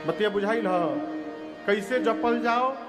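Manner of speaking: normal talking voice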